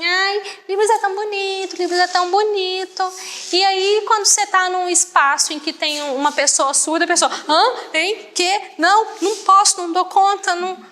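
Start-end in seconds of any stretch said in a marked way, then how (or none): 0.1-3.1 high, singsong fawning praise; mock gushing. Imitating hearing people praising Libras, mock gushing. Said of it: "se a gente falasse assim". 7.5-10.8 mock-baffled, high short bursts; flustered refusal. Imitating someone facing a deaf person, flustered.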